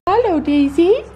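high cooing hello to the horse; pet talk. Real, not acted. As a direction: greet the mare in a high, sweet voice.

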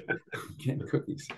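whispered, sneaky confession; whispering. Real, not acted. Repeats it in a whisper.

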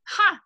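loud high scornful ha; scornful snort. A narrator's reading. Headmistress scoffs at the teacher, loud snort. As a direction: spit out a mocking ha. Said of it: "snorted Miss Trunchbull".

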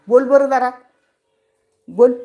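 teasing a noisy pet bird; talking to the parrot. Real, not acted. Coaxing and scolding the parrot.

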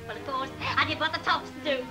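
ordinary delivery

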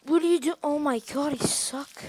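startled yelp, high and loud; scared horror game streamer. Real, not acted. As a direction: jolt at the jump scare and cry out.

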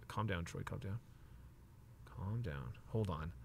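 soft, hushed self-talk; self-soothing. Real, not acted. Collector soothing himself over stuck cards, hushed. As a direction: quietly coax yourself back to calm.

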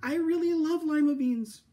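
high, loud blurted admission; a girl finally admitting it; bursting confession. Acted. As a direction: let it all burst out. Camilla confesses loudly.

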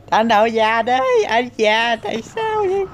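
squeaky falsetto complaint; cartoonish high-pitched whining; high mock whine.

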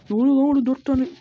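suddenly alarmed by the attack; alarmed outburst. Real, not acted. (şaşkın ve yüksek sesle bağırış)

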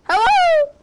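high, loud shouted hello; calling out. Real, not acted. Calling out to a figure in the game, loud and high. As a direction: call out sharply across the distance.

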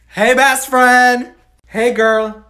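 loud, slow, high calling out; excited call. Acted. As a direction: call across to her, stretched out. Calling out to friend.

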